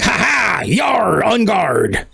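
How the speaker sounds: high, cocky pirate bravado; swashbuckler taunt. Acted. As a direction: taunt like a dashing duelist. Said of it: "she's like".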